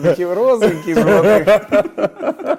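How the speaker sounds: high, loud, laughing. Real, not acted. Laughing description of falling.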